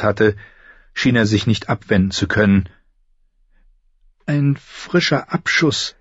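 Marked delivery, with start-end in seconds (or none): 4.3-5.9 nervous, high, halting voice; high and hesitant, feeling for each word; Samwell Tarly. Acted. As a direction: stammer timidly to a superior. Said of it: "Sams nervöse, hohe Stimme".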